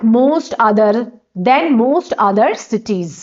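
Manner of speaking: stretched out, word by word; very slow dictation. Shown as a demonstration. Teacher dictating the answer to students, very slow. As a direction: lay each word down slowly for them to copy. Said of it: "very few को क्या बना दोगे आप?".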